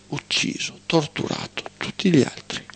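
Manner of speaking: whispered, dropping to a hush. Real, not acted. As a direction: breathe the words out grimly. Grim list of atrocities.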